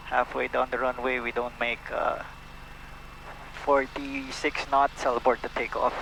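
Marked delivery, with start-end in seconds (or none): none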